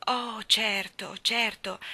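drawn-out slow uncertain agreement; slow hesitant. Acted. Michael pretending he knows the girl, slow and hesitant. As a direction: stall for time unsure. Child bluffing she knows her.

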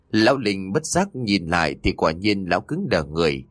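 ordinary delivery

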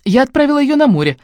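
high, loud, gleeful announcement; cheerful report. A narrator's reading. Sister reporting to her brother about his daughter, cheerful report. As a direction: report it brightly, pitched right up. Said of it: "радостно отрапортовала я".

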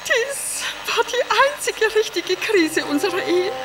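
high-pitched, crying grief; tearful lament. Acted. Wife recalling the crisis over her vanished lion, tearful.

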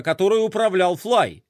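ordinary delivery

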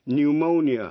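Pneumonia said new-mo-nia, no p; silent p. Shown as a demonstration. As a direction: Pneumonia starting on the n. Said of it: "câm, không có đọc".

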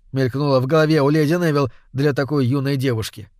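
ordinary delivery, nothing marked

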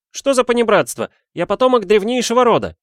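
very high, loud aristocratic protest; indignant cry. A narrator's reading. Offended student scolds a too-familiar servant, high indignant shouting. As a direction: shriek in offended noble pride. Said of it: "вскричал он".

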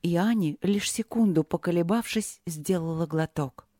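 ordinary delivery, nothing marked

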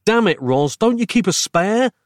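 mild shout climbing high at the end; exasperated shout. A narrator's reading. Narrator to Roz over dead batteries, shouting mildly. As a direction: demand an answer crossly. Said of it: "I shouted".

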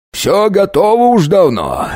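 high, slow and sugary; fawning official. A narrator's reading. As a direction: fawn over the noble guest. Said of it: "до чего ласково разговаривал". (заискивающий чиновник)

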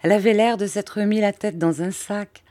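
ordinary delivery

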